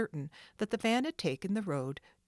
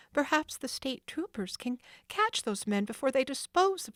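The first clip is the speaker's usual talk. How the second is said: put-on higher girl's voice; hopeful planning; Nancy hoping; 7 semitones higher than usual. Nancy hoping troopers catch the thieves.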